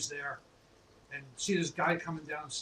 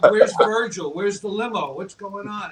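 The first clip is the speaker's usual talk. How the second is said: loud, high, starstruck questions; a much louder starstruck fan, pitch up high; excited fan; 5 semitones higher, 14 dB louder, slower than usual. Retold or imitated. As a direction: fire them off like a thrilled fan.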